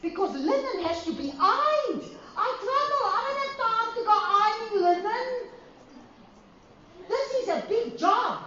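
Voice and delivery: high, laughing plea to the Father; laughing protest. Retold or imitated. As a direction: lachend protesteren, hoog en klaaglijk. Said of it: "I said".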